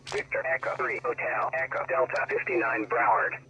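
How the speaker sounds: usual speaking voice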